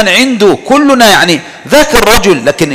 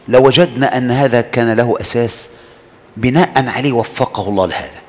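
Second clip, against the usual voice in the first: soft reflection; 6 semitones lower, 6 dB quieter than usual. Acted. Low reflective musing.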